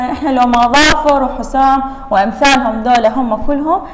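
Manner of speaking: ordinary talk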